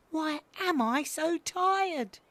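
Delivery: put-on high, slow baby owl; drawn-out squeaky puzzlement; tired and puzzled. A narrator's reading. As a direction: wonder it wearily in a little voice.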